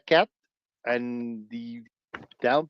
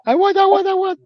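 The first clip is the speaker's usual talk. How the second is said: high whiny voice; 6 dB louder, faster than usual. Acted. Mocking childish whine.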